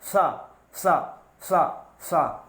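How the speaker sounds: "सा" pushed out with puffed cheeks, the last two slow; puffed retroflex sa. Shown as a demonstration. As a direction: air built up inside, then "सा" released slowly. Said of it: "फिर बाहर निकलेगी". (गाल फुलाकर धीरे धीरे "सा, सा")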